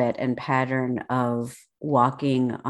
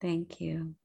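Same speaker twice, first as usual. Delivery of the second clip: ordinary talk, nothing marked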